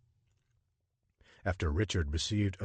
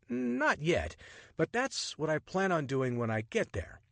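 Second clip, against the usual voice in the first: lightly voiced charming rogue, higher and playful; cheeky adventurer; 9 semitones higher than usual. Richard flirting with the woman on the Nile train, playful and cocky. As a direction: tease her with easy confidence.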